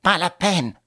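firm call back, needing nobody; self-sufficient refusal. A narrator's reading. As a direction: turn the offer down with full confidence. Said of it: "d'un ton qui signifiait: «Je n'ai besoin de personne".

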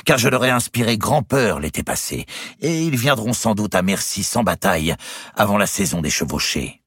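high, emphatic kingly boast, settling at the end; exclaiming. A narrator's reading. King boasting of frightening the Flemish, exclaiming. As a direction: proclaim it confidently, then ease off. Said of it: "s'écria le hutin".

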